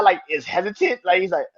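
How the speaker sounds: ordinary talk, nothing marked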